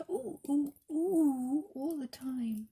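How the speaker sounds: high, tiny repeated oohs from the smallest bear; littlest bear ooh. Acted. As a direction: squeak it out like a baby teddy bear. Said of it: "the littlest of all just said".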